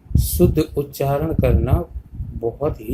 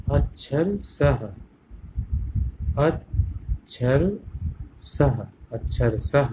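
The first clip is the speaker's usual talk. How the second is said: drawn out word by word for pupils; अक्षर सह spelled out slowly, part by part; slow syllable demo; much slower than usual. Shown as a demonstration. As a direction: stretch each part slowly, teacher style. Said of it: "इस तरह से हम दूसरे शब्द को पढ़ेंगे".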